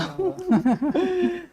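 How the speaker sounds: high, soft laugh. Real, not acted. Speaker to audience after the child example, amused laugh. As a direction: laugh lightly to yourself.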